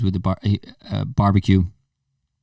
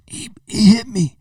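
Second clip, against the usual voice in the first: high, slow, whining complaint; whiny kid; 11 semitones higher than usual. Retold or imitated. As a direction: whine like a hurt kid. Winded kid tells his mom.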